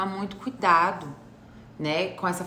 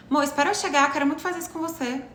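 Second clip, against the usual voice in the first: higher-pitched, open request; pedido direto; 7 semitones higher, faster than usual. Acted. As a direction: ask plainly and affectionately, quicker at the end. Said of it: "não seria mais fácil eu falar assim". (pedido claro e direto, voz mais aguda)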